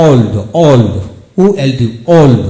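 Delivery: "ওল্ড" said with a clear long o, spelt out between; teacher's model of "ওল্ড"; model pronunciation. Shown as a demonstration. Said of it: "ও দিয়ে শিখব". (শিক্ষকের উচ্চারণে "ওল্ড")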